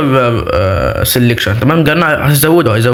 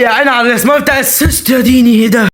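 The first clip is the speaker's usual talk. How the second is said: excited shout; 6 semitones higher than usual. Real, not acted. Reacting to a slick assist.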